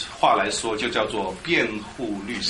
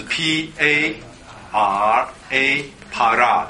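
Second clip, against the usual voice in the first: "P A R A" letter by letter, slowly, then "Para"; spelling out; much slower than usual. Shown as a demonstration. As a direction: dictate "P A R A" slowly to the class. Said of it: "罗马拼音的话".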